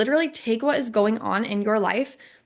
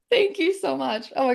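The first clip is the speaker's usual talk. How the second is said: high laughing thanks; 7 semitones higher than usual. Real, not acted. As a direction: giggle the thanks. Laughs at friend's help.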